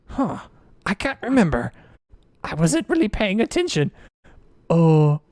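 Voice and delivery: dopey dwarf conferring, rising high; puzzled, drawn-out character voice; bumbling duergar twins. Acted. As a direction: play a forgetful dwarf henchman.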